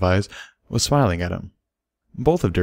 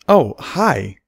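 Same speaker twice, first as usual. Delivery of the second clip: high, louder startled greeting; surprised gasp; 6 semitones higher, 5 dB louder than usual. A narrator's reading. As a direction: caught off guard at her own door. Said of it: "gasped Derpy in surprise".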